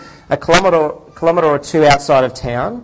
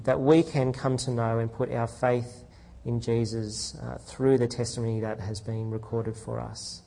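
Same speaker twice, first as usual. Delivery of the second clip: prayer; 4 semitones lower, 7 dB quieter than usual. Acted. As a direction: pray quietly and humbly before the congregation. Opening prayer before the sermon.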